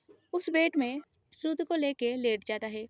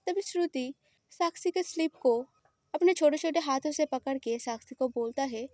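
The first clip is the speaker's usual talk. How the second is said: usual speaking voice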